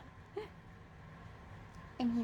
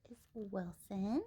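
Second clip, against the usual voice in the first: normal talking voice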